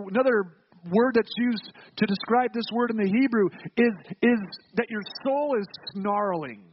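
ordinary delivery

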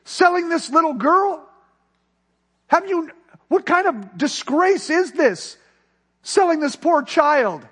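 high, loud outraged cry; retold anger. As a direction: hurl the outrage at him, voice up high. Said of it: "the anger ri-, ri-, raised up in him".

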